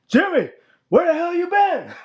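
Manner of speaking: high, loud mock boss greeting; Vince. Imitating the boss calling Jimmy over, high and loud. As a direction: squeal it like a boss who forgot firing him. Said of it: "Vince passes by me and is like".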